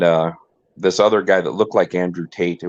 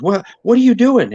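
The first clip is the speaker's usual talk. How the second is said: high, louder mock panic; soy boy; 13 semitones higher, 6 dB louder than usual. Mocking the husband running up to the cops, high and loud. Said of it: "he goes".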